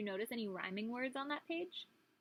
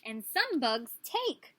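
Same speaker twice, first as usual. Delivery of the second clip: put-on reading to children; 3 semitones higher, 4 dB louder, slower than usual. Reading book aloud.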